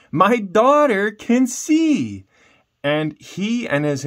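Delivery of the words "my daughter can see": much higher and louder, slow overjoyed cry; joyful exclamation. Acted. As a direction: shout the miracle out in delight. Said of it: "Marcus exclaimed".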